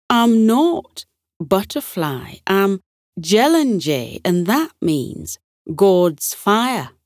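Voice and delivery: put-on slow, proud Scottish accent; a lightly voiced Scottish butterfly naming himself. Jellinjay proudly tells the butterflies his name, slow Scottish accent. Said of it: "the one with the Scottish accent".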